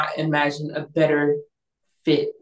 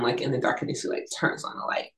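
ordinary talk, nothing marked

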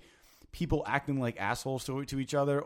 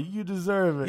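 mock drunk; 6 semitones higher than usual. Mocking the drunk's line.

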